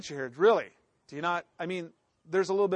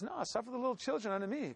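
put-on softer, welcoming the children; gentle Jesus; 4 semitones higher, 8 dB quieter, faster than usual. Jesus welcoming children, soft and gentle. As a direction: gently invite the little ones. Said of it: "And Jesus says".

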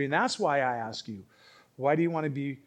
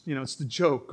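ordinary talk

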